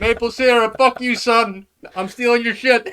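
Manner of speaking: high, loud taunting shout; gloating yell. Acted. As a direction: taunt him loudly as you steal it. Seltzer guy gloating theft.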